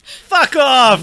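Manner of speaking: incredulous. Retold or imitated. Acted reaction to casting news.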